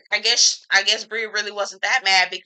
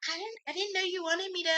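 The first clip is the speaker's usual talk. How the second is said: much higher, softer, fast whimpering excuse; mock whiny excuse; 12 semitones higher, 10 dB quieter, faster than usual. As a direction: play her as a flustered, half-crying dodge. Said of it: "She's like".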